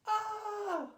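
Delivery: put-on very high, loud girlish shriek; loud scream. A narrator's reading. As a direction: scream at the top of her lungs. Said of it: "she let out a scream".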